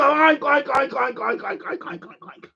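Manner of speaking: oink repeatedly, trailing off into almost nothing; rapid-fire piggy imitation that peters out. Mocks being called chauvinist pig.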